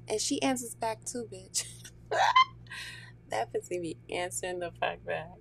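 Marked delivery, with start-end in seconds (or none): 1.7-3.2 very high, loud cackling laugh; shrieking laugh. Real, not acted. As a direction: break into a squealing cackle. Laughs at own joke.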